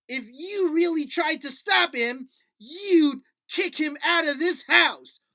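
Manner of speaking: puppet stamping its foot in mock fury; puppet anger. Puppet retorts to teacher.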